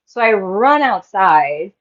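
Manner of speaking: usual speaking voice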